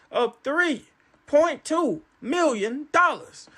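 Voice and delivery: every word drawn out very slowly; slow, weighty announcement; slow dramatic reveal. Real, not acted. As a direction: savor the number like a jackpot. Revealing lottery amount.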